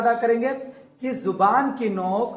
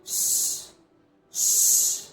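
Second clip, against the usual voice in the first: a voiceless ش like calling someone's attention; whispered ش hush; shushing sh. Shown as a demonstration. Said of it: "تو ایسے نکالنا".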